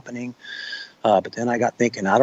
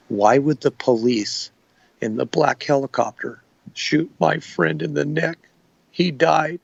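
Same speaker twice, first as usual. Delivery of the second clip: slow, higher, dazed retelling of a shaken man; traumatized man's words; 4 semitones higher, slower than usual. Retold or imitated. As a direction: imitate a man in shock, slowly. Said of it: "his words that he said".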